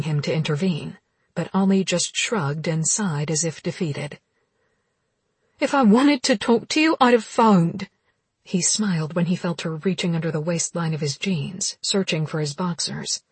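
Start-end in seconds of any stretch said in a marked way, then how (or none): 5.6-7.9 angry. Acted. Heroine snaps at him.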